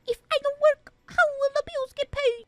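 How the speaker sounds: high, exaggerated copy of her whine; mocking imitation. Dad mocking his daughter's complaint, high mimicking whine. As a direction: parrot her line back to mock her. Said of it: "Are you making fun of me?".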